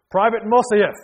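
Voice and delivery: loud, high shout of a name; calling out. Acted. Major calls the departing soldier back, loud shout. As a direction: bark the name across the room.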